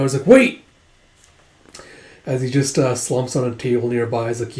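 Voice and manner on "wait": sudden high, loud yelp of alarm; a frightened shout, cutting the other man off; panicked cry. Retold or imitated. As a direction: blurt it out in a panic. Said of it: "Ogi's like freaking out".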